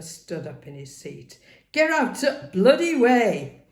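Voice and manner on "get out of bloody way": loud, high, furious bellow; angry shout. A narrator's reading. Wagon driver yelling at the lad blocking his way, angry shout. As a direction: roar him out of the road. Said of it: "he shouted".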